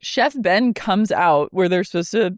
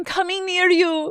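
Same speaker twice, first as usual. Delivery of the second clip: much higher, defiant imitation; march forward like a defiant Whitney; mock Whitney; 12 semitones higher than usual. Repeats Whitney quote mockingly.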